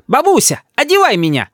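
put-on high-pitched bossy little boy; high childish command; child shouting. A narrator's reading. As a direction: demand it like a spoilt grandson. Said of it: "кричит Рис".

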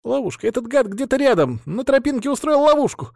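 high-pitched squeal of pain. Acted. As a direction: squeal it out, hurt and panicked. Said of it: "визжащим голосом ответил проводник".

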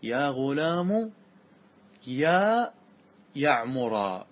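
drawing out "يا غلام يا يعمر" to show the repeated vocative; spelled-out repetition. Shown as a demonstration. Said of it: "ستقول". (نطق بطيء جدا ممدود)

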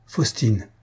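usual speaking voice

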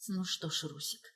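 very soft, low near-whisper. A narrator's reading. Wife alone in the car addresses her absent husband, whispering. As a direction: murmur it under your breath. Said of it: "прошептала она в пустоту салона".

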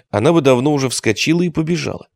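ordinary delivery, nothing marked

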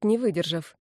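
usual speaking voice

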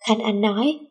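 ordinary delivery, nothing marked

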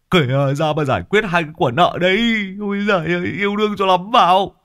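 high, reproachful scolding; exasperated nagging wife. A narrator's reading. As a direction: shove him out and scold his philandering. Grumbling wife pushes husband out.

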